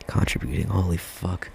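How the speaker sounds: prim, softly clipped posh English; posh accent. Real, not acted.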